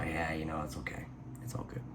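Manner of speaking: soft, meek whisper brushing it off; mock whisper. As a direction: whisper as if it doesn't matter.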